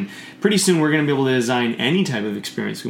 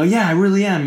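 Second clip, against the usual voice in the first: louder, higher mock-angry confirmation; acted anger; 7 semitones higher, 5 dB louder than usual. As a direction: act out an angry person agreeing emphatically. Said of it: "their tone is super angry".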